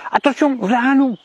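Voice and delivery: high-pitched put-on warning to the flock; mock alarm cry. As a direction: cry wolf in feigned panic. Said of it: "je leur crie".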